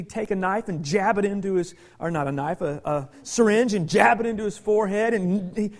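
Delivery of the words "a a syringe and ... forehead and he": ordinary talk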